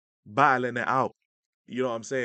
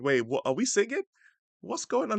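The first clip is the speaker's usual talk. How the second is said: retold confusion; 9 semitones higher than usual. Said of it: "my head snapped up like".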